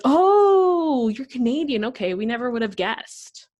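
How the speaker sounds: loud, high, stretched "oh" easing down; mock realization. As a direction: imitate classmates having an exaggerated revelation. Classmates' reaction to learning she's Canadian.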